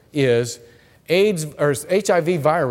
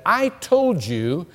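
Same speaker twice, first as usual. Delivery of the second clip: imitating an indignant student, higher and emphatic; mock indignation, pitched up and insistent; 7 semitones higher than usual. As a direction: push back in a raised, affronted voice. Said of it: "She looked at me indignant".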